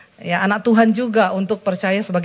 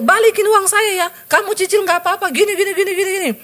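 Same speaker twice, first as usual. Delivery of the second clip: much higher, coaxing plea to pay back; much higher, coaxing plea for repayment; pleading demand; 10 semitones higher, faster than usual. Retold or imitated. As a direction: wheedle for the money in instalments.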